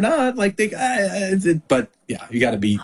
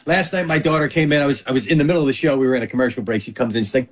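ordinary talk

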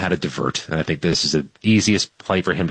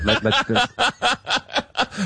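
laughing; much slower than usual. Real, not acted. Host laughs at the lake joke.

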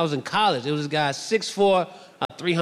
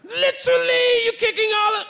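very high-pitched mock British yell; hammed-up British security guard; yelled British accent. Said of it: "He's yelling at me in British".

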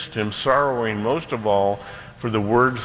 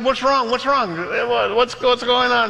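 high-pitched, urgent questioning; retold captain's alarm; 11 semitones higher than usual. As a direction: demand answers in a mock panic. Captain alarmed as boat falls back.

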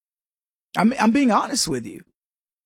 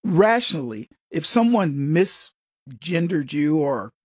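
ordinary delivery

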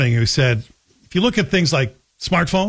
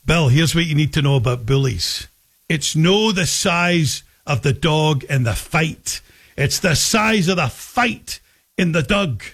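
imitating dad's Scottish accent. Dad advising about bullies, lightly imitated Scottish accent. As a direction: retell Dad's saying in his Scottish accent, "no" for not and "dug" for dog. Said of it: "He used to say with his Scottish accent".

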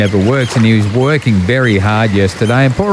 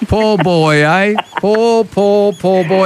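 higher, sing-song teasing pity; mock sympathy; 8 semitones higher, slower than usual. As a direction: coo over him as if he's a baby. Teasing colleague with man flu.

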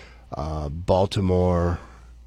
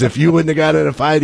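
louder, faster, hearty praise; gruff approval; 9 semitones higher, 7 dB louder, faster than usual. Retold or imitated. Old-school manager to his young catcher, loud hearty approval. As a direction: slap him on the back with it.

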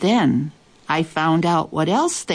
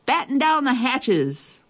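captain's order; 8 semitones higher than usual. A narrator's reading.

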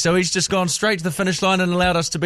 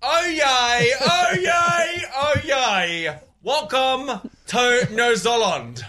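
excited town crier; 4 semitones higher, much slower than usual. Shown as a demonstration. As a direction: announce it like it's your first time. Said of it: "Sound excited, excited".

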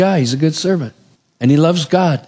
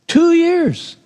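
"Two years" said much higher, falling hard on "Two"; emphatic two years; 12 semitones higher than usual. Real, not acted. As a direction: lean on "Two" to show how long it was. Stressing the long wait.

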